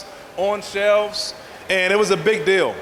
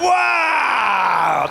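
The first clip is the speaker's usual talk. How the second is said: awed wow; 3 semitones higher than usual. Retold or imitated. Seeing himself on the shelf.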